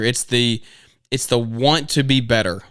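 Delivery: usual speaking voice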